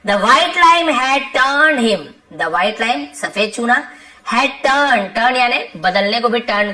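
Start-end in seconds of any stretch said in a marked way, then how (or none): 0.1-2.0 very slow, word by word, a bit louder; slow dictation. Shown as a demonstration. Teacher reading the lesson sentence to students, slow and deliberate. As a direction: read it out slowly so the class can follow.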